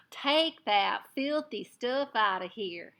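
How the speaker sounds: loud, slow Texas cowboy shout; angry Texan drawl, shouted; shouted Texas accent. A narrator's reading. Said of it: "he suddenly shouted in a strong Texas accent".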